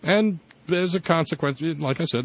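ordinary delivery

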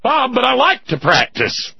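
high, loud, teasing voice; cheeky listener quote. Retold or imitated. Host reading a listener's joke in a high, loud cheeky voice. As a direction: play it coy and cheeky, pitched high. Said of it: "Practicing homosexuality".

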